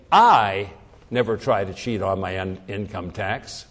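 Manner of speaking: "I" punched and set apart, the rest dropping away; emphatic "I", as if others do; stress on I. Shown as a demonstration. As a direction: hit "I" hard, then let "never try to cheat" fall back. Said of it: "what it means when I say".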